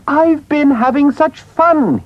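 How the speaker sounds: high, slow delighted gush. A narrator's reading. Mole tumbling in, pleased with himself, high delighted gush. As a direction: Mole bursting with glee. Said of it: "very pleased with himself".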